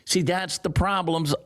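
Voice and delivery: ordinary delivery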